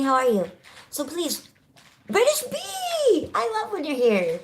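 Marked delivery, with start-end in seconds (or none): 2.1-4.4 high, drawn-out name; excited greeting. Real, not acted. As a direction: sing out the name slowly. Greeting viewer in live chat.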